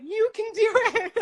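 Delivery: high, loud laughing cheer; joyful cheer, laughing through it. Retold or imitated. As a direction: cheer them on from the rooftops. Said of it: "I'm, like, shouting it from the rooftops".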